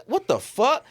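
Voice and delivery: high-pitched, indignant disbelief; startled, high retort; indignant shock. Retold or imitated. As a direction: act out being taken aback, voice shooting up. Retells reacting to being hit.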